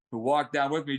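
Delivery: ordinary talk, nothing marked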